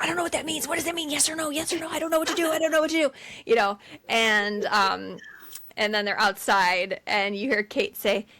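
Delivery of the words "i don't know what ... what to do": high, flustered babbling; mock panic. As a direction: imitate someone at a loss, repeating in a fluster. Acts out panicked phone indecision.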